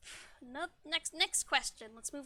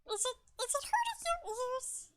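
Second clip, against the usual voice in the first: high squeaky chipmunk question; 11 semitones higher than usual. Shown as a demonstration. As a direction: keep the helium squeak. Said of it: "a chipmunk-like voice".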